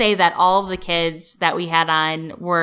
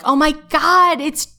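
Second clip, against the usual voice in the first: high, louder starstruck exclamation; starstruck gasp; 10 semitones higher, 5 dB louder than usual. Retold or imitated. Imitating a starstruck fan who spots a star, high and louder. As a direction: gush at meeting a famous actor. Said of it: "you're like".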